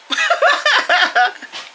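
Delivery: loud high-pitched laugh breaking out; high burst of laughter. Real, not acted. Admitting to viewers she's PMSing, loud high laugh. Laughs confessing PMS now.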